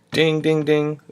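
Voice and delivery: light mock alert chime; imitated bell.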